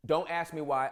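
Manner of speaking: normal talking voice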